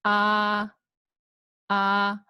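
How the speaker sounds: "ah" held flat and level; steady level "ah"; first tone. Shown as a demonstration. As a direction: sustain each "ah" on one note. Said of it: "The first tone is".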